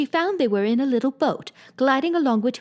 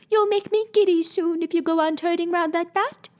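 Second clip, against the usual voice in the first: lightly voiced fussy sheep, higher and scolding; a touch of a fussy old sheep, higher; mild higher voice of a scolding sheep; 7 semitones higher than usual. Sheep character scolding Alice.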